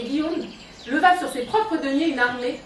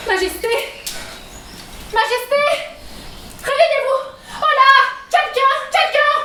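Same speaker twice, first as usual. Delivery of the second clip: fluttering cries for help; 3 semitones higher, 3 dB louder than usual. Acted. Queen faints, calling for help.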